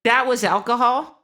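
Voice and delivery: high, loud, appalled question; shocked disbelief. Acted. Monk realising the stars were alcohol, shocked loud question. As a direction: blurt it out, aghast.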